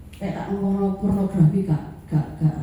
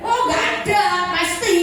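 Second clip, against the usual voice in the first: high sing-song mock denial, the congregation pretending innocence; mock innocent denial; 6 semitones higher, faster than usual. Preacher mocks the congregation claiming nobody watches, high sing-song. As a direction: sing it up high like a guilty person saying no.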